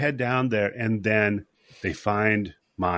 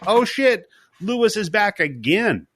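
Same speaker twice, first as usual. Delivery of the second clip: higher, louder startled outburst; shocked retelling, pitched up; startled alarm; 10 semitones higher, 4 dB louder than usual. Retold or imitated. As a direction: gasp at the guy who won't die. Lewis suddenly back, retold.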